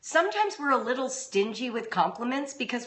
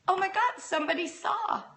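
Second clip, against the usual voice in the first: pitched way up in glad surprise; mother retelling her own stunned joy at being seen; amazed delight; 10 semitones higher than usual. Retold or imitated. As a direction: gasp of relief that someone noticed. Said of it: "I was like".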